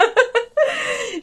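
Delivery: loud laugh at herself; high laugh. Real, not acted. A knitter laughing at her own rambling, high laugh.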